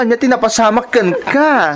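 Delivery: usual speaking voice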